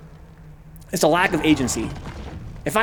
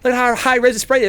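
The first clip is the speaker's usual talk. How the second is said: higher, sarcastic gushing over the sprite; mock praise; 6 semitones higher than usual. Mocking the game devs with sarcastic high praise. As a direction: gush with fake delight. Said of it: "I'd be like".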